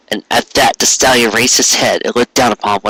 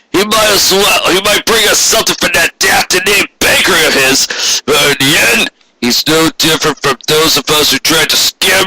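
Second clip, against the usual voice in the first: higher, heated boss voice building in scorn; cynical boss ranting; 8 semitones higher than usual. A narrator's reading. Boss warning his clerk about Cake, a scornful rising rant. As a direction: spit out the contempt for the customers.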